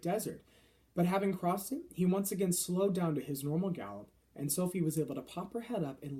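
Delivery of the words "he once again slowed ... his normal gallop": ordinary delivery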